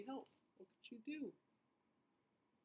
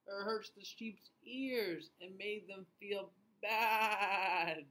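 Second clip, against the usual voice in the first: story reading; 4 semitones higher, 7 dB louder, much slower than usual. A narrator's reading. Reads story to children.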